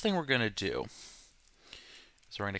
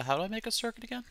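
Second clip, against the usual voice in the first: high, quick, playing dumb; mock cluelessness; 12 semitones higher, faster than usual. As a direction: ask it high and fast as a joke. Joke question, then just kidding.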